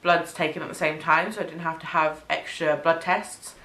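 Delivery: ordinary talk, nothing marked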